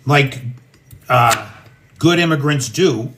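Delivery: ordinary talk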